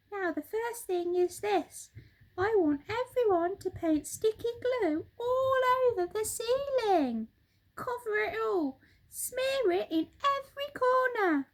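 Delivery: high, bright-pitched excited monkey voice; Mugglewump the monkey. Acted. Monkey father showing birds the living room, high excited voice. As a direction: chatter it out high and quick like an eager monkey.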